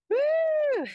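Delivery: high loud whoop; cheering woo. Real, not acted. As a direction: cheer like you just finished. Cheering after finishing set.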